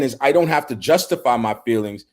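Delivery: ordinary talk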